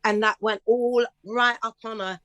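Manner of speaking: ordinary talk, nothing marked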